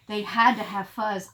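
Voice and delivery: usual speaking voice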